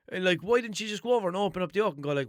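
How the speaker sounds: quick, high, loud run of complaints; fast exasperated rant. Real, not acted. Listing what the clerk should have said, fast and high. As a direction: rattle it off in disbelief. Venting, acting sensible clerk.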